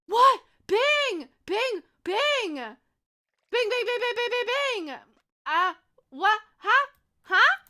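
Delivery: high, loud, thrilled calls of the name; excited shouting. Real, not acted. Reacting to viewer's gift.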